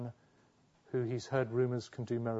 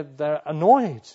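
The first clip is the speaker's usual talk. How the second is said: ordinary talk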